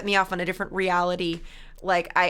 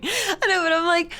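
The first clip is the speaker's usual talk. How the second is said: mock whine; 8 semitones higher, 4 dB louder than usual. Pleading with chat.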